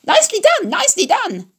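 very high, sing-song goose call; honking goose cry. A narrator's reading. Goose urging Wilbur to dodge, high honking cry. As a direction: squawk the orders like a flustered goose. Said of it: "cried the goose".

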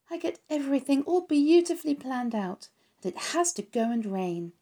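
a little pig disappointed by the rain; Wilbur the pig. Acted. Wilbur voiced in story.

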